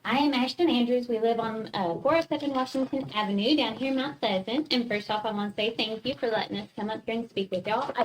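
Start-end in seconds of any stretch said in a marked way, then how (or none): none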